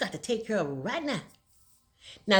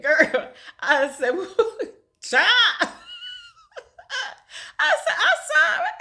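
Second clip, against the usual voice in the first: laughing through it; 12 semitones higher, 4 dB louder, slower than usual. Retold or imitated. Laughing retelling of apology to lady.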